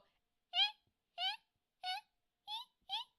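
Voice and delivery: short, very high "eh" squeaks; chocobo squeak, eh noise. Retold or imitated. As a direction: squeak out spaced little "eh" chirps. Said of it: "if I had to do the chocobo sound".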